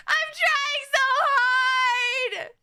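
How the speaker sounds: high, drawn-out playful whine; mock despairing wail. Real, not acted. Streamer pleading with guys after a slip, long high wail. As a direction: wail it slowly, stretching each word.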